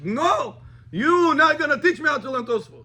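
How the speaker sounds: high, loud, indignant refusal; angry rabbi shout. Retold or imitated. Rabbi yelling at the student who argues, angry shout. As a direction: snap back like an offended teacher.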